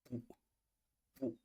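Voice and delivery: "Pou" dropped low and short; low-toned, brief "Pou". Shown as a demonstration. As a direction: let the voice sink on "Pou". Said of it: "Je fais un abaissement dans la voix".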